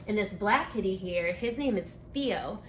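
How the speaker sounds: ordinary delivery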